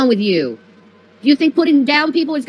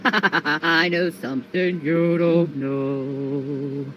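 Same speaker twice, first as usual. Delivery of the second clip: slow taunting; 9 semitones lower, 5 dB quieter, much slower than usual. Acted. Slowed taunt.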